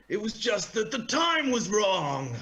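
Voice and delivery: high, loud and slow drawn-out punchline; mock dramatic reveal of the pun. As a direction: land the last words big and slow. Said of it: "And he goes".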